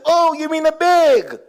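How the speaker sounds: high, drawn-out foreign twang on "bag". Retold or imitated. Shop lady finally understanding the customer, exaggerated foreign twang. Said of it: "pardon Jamaicans who twang".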